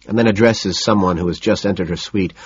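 usual speaking voice

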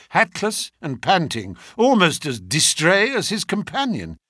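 usual speaking voice

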